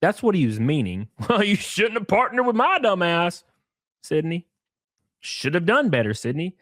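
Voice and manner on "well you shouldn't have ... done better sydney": mocking the partner.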